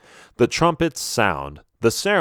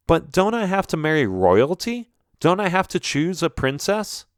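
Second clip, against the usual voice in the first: higher, questioning prince; puzzled disbelief; 7 semitones higher than usual. Acted. Prince questions his parents about the royal rule, puzzled. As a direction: query it in mild astonishment, pitch raised.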